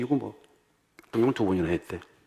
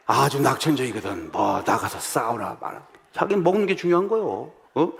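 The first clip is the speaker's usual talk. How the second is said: ordinary talk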